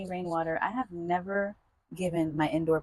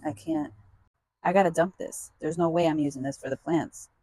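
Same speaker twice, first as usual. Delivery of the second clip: ordinary talk, nothing marked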